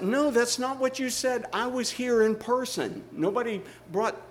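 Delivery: a husband half-playfully arguing his case; mock indignant husband.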